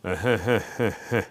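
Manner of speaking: repeated, evenly spaced chuckles; imitated stoner chuckle. Showing how a classmate always laughed, repeated imitated chuckle. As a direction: keep a dopey little laugh ticking over. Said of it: "it was that thing of just kinda".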